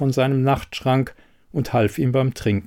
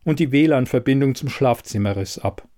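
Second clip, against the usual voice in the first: ordinary delivery, nothing marked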